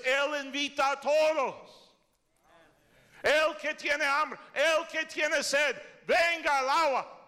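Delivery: loud, slow, high sermon cadence; preaching at full force. Acted. Pastor proclaims God's invitation to the thirsty, loud slow preaching.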